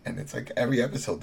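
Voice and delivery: ordinary talk, nothing marked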